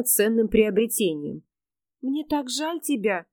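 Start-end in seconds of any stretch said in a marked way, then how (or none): 2.0-3.3 put-on soft, slow lisping girl; slushy lisp. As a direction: lisp it softly and slowly. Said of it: "шепелявя проговорила она".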